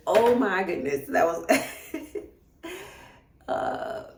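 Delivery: loud outburst into tearful laugh; overcome with emotion. Real, not acted. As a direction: burst out, flustered by real tears. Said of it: "This is making me really emotional".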